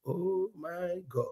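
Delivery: slow, soft oh my God; awed softly; hushed awe. Real, not acted. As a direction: let it fall quietly in awe. Reacting to his own question.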